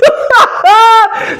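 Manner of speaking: high, loud burst of laughter; loud delighted laugh. Real, not acted. Laughs at Ellie's comeback.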